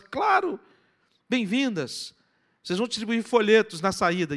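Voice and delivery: high-pitched, delighted yes; acted enthusiastic acceptance; eager welcome. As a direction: welcome eager volunteers with gusto. Acted welcome to volunteering girls.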